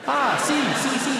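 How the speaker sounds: put-on high Spanish-accented "si, si, si"; Spanish barman. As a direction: brightly agree like a Spanish barman. Spanish barman finally understands.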